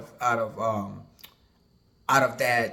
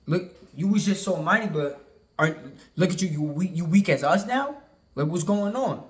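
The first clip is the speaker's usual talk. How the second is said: higher-pitched, incredulous mockery; taunting nations; 5 semitones higher than usual. Nations taunt the once mighty one, higher-pitched incredulous jeer. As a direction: voice the nations marvelling at the fallen one. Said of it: "the nations are gonna say".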